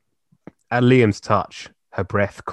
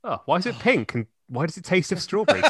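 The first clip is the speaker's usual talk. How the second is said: higher, fast mock puzzlement; mock wondering voice; 9 semitones higher, faster than usual. Joking about the shower water, high mock puzzlement. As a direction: play it up as a dim character.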